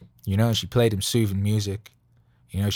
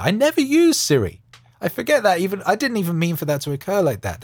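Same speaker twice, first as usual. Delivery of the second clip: flustered disbelief; 9 semitones higher, 4 dB louder than usual. Real, not acted. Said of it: "That's just terrifying".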